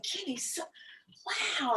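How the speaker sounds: high, hushed whisper of wonder; breathless awe. Retold or imitated. Runner marvelling to her friend Kenny, hushed awe. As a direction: gasp it out in amazement. Awed during the run.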